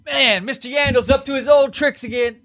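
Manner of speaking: put-on high bratty kid. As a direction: whine like a cheeky schoolkid. Kid voice mocking driver.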